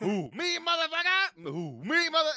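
high loud hoot then yell; hoot, then screech the curse; mock owl screech. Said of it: "You're like".

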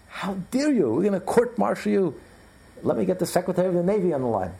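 high, indignant threat; outraged admiral. Acted. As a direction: pull rank in rising outrage.

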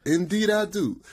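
imitated Southern rapper accent, pitched high. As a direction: do a hammed-up accent, high and formal. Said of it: "it's like he talk like that".

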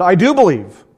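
high, loud cry of faith; cried out. Retold or imitated. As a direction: call it out, desperate. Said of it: "cried out".

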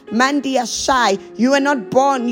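normal talking voice

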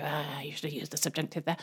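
low, hushed mock know-it-all correction; lightly voiced grammar stickler, said softly; grammar-minded French people. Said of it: "they'll go".

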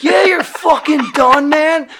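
high, slowed, emphatic shout; retold outraged outburst; exasperated yell. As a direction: tell him off at high pitch. Angrily answers the grave digger.

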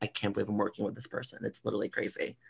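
soft, quick starstruck aside; hushed disbelief. Acted. Starstruck worker beside a famous actor, hushed disbelief. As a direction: say it under your breath, amazed.

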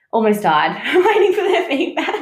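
high, laughing confession. Real, not acted. Psychic recalling waiting for first feedback, laughing. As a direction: let a laugh break up the line.

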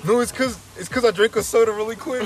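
high, loud hurried excuse; frantic excuse. Retold or imitated. Son makes a desperate excuse to his mom, frantic. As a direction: talk fast to get out of trouble.

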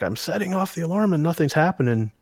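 high-pitched mock complaint; imitated bitching. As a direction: imitate a wife griping, voice pushed up high. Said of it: "She's bitching and bitching and bitching".

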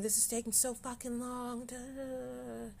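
mock-exasperated grumble, trailing into da da da; frustrated mock whine. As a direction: whine it like you're fed up and impatient.

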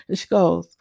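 ordinary talk

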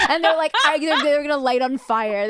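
ordinary delivery

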